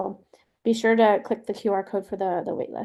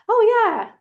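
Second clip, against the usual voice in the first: higher, louder acted "oh yeah"; breezy agreement; 11 semitones higher, 6 dB louder than usual. A parent happily letting Aria go with a friend, breezy agreement. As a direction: pitch it up like an easygoing parent agreeing.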